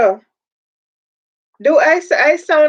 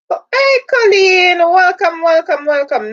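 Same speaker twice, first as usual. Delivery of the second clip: excited welcome; 6 semitones higher, 5 dB louder than usual. Real, not acted. Greeting a newcomer on the stream.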